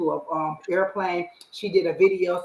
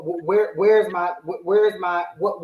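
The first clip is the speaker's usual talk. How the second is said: ordinary delivery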